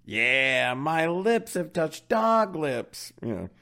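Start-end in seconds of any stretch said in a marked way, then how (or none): 0.7-3.1 high, slow mock disgust; mock disgusted Lucy. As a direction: ham up the grossed-out girl. Mocking reprise of Lucy's line.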